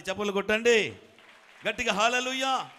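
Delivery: loud, high call to clap; rousing preaching. Acted. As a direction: drive the crowd to clap hard. Preacher calls for loud applause.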